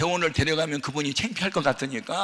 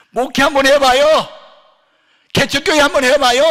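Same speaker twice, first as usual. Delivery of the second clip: loud, high anguished outcry to the Lord; crying out to God; 12 semitones higher, 15 dB louder than usual. Retold or imitated. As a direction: shout it up to heaven in despair. Preacher cries out to God. (하나님께 울부짖는 큰 외침)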